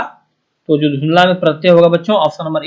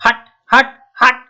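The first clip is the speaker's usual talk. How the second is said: high sharp हट, हट on a raised pitch; teacher hitting the ending of चिल्लाहट, pitched up; syllable drill; 7 semitones higher, slower than usual. Shown as a demonstration. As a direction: snap out हट high, again and again. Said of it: "ऐसा आ रहा ना".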